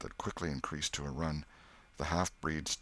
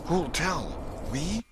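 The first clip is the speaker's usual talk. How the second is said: put-on high, alarmed boyish voice; startled boy's question; 12 semitones higher, 3 dB louder, slower than usual. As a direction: ask it scared and sharp. Tom frightened question.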